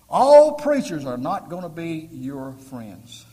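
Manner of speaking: ALL hit hard, loud and slow; booming pulpit warning, every word weighted; slow emphatic shout. Acted. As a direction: drive the warning home at full volume. Emphatic warning about preachers.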